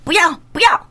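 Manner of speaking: high-pitched mimicked child's refusal; refusing toddler. Grandmother imitating grandchild refusing to dress, mock toddler protest. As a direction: mimic a little child saying no. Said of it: "伊不爱穿".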